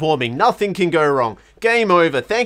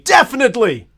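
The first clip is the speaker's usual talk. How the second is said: emphatic; 6 semitones higher, 6 dB louder than usual. Acted. Repeating a flat word with gusto.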